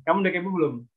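ordinary delivery

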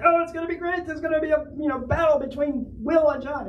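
high-pitched gushing mock excitement; excited fans. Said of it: "super excited about it".

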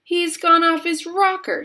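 high, loud, alarmed outburst; aghast shout. A narrator's reading. A father shouts about Wonka, aghast. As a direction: shout it out in horror. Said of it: "shouted one of the fathers, aghast".

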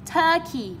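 turkey with TUR high, key falling; stress on first syllable. Shown as a demonstration. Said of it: "chúng ta đã gạch hết trọng âm một".

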